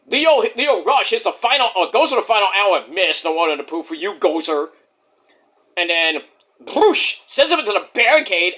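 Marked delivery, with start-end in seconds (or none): none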